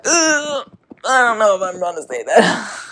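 high, whiny groan of mock dread; cringing reluctance. Podcaster mimics her own unease at calling herself psychic medium, whiny cringe.

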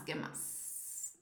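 breathy whisper, muttered; whispered to herself. Real, not acted. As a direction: whisper it to yourself while thinking. Repeats question under her breath.

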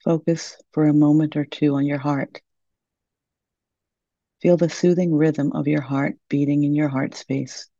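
normal talking voice

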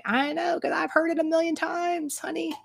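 very high, lilting put-on voice; playful high voice. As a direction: tease in a sing-song high pitch. Playful aside about hearing it often.

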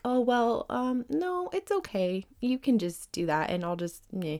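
mock meek demurral, starting high and softening; hesitant, hammed-up passive-aggressive martyr. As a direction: imitate someone politely hiding what they want. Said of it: "weird passive aggressive way of being".

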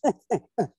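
high, loud mock bull bugle, hooted; imitated elk bugle. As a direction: hoot it out like a bugling bull elk. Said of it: "he's just sounded like a, like a gorilla himself".

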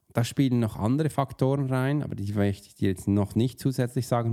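ordinary talk

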